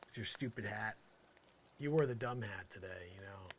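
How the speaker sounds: ordinary talk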